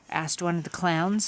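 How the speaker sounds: plain reading voice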